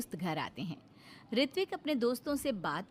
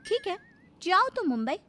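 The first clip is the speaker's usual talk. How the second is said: higher, louder grudging go-ahead; grudging consent; 9 semitones higher, 5 dB louder than usual. Acted. Wife to husband planning a Mumbai trip, grudging. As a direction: let him go, pointedly.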